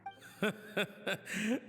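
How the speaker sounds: a light, high fatherly chuckle; fond laugh. Acted. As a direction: laugh along at her big dream. Dad amused by daughter's dream.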